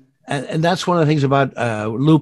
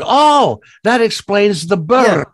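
high, louder sarcastic oh then slow; mock surprise; 8 semitones higher, 5 dB louder, slower than usual. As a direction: fake dawning realization. Mock realization retold.

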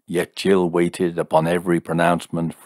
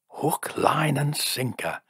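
unvoiced, delighted hoarse whisper; gleeful secret whisper, barely breathed; 9 semitones higher, slower than usual. A narrator's reading. Jim to Fred after Jill leaves, gleeful hoarse whisper. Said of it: "in a hoarse whisper of delight".

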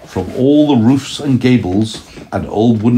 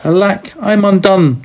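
high, louder wailing lament; sad lament; 11 semitones higher, 3 dB louder than usual. Acted. Grandma voicing the tailor's high despairing lament. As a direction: wail it in despair. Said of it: "said the Tailor of Gloucester, and went sadly to bed".

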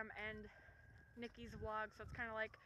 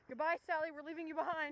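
higher, louder taunting goodbye; mock farewell; 5 semitones higher, 9 dB louder than usual. Leaving dog Sally behind, loud playful taunt. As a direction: tease the dog dramatically.

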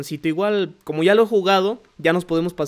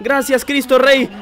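laughing thanks; 7 semitones higher, 5 dB louder, slower than usual. Real, not acted. Relieved laugh after hit.

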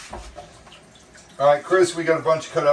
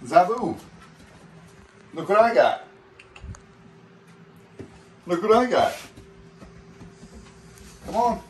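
high, louder sing-song coaxing; coaxing pet voice; 9 semitones higher, 4 dB louder than usual. Real, not acted. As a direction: tempt him up with food in a high voice. Coaxing lemur with food.

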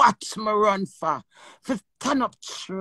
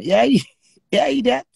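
laughing taunt; 6 dB louder than usual. Real, not acted.

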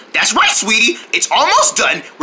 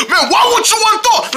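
yelling across the room; 11 semitones higher than usual. Acted. Mom shouting at frozen turkey.

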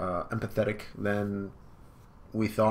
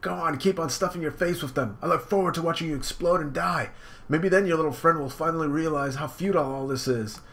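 taunting Cracker; 6 semitones higher than usual. Acted. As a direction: sneer at him, high-pitched. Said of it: "And he's like".

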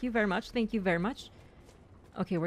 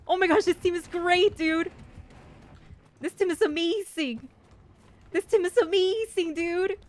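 thrilled praise; 8 semitones higher than usual. Real, not acted. Player raves about the team, thrilled. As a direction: gush over your teammates.

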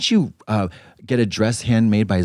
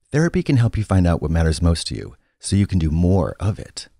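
ad read; 5 semitones lower than usual. Acted. Comedic ad read.

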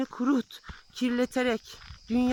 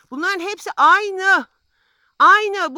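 louder and slower, "aynı" punched down hard; emphatic; 3 semitones higher, 6 dB louder, slower than usual. Real, not acted. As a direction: hammer the word "aynı" home twice. Hammers the point home.

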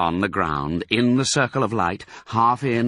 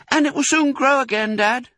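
put-on very high, louder child voice; small fox child; 5 dB louder than usual. A narrator's reading. Small fox asking Dad about his tail, hopeful child. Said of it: "said one of the small foxes".

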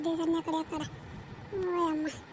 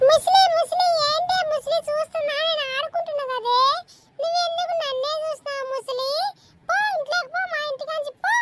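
put-on higher, cheeky kid taunting; cheeky child; 10 semitones higher, 5 dB louder than usual. Child taunting the old landlady, higher cheeky voice. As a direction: tease the old woman like a brat.

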